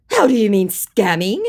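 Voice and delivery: high, loud, incredulous mock question; mock scammer. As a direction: parrot his protest with a rising swoop. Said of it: "he said".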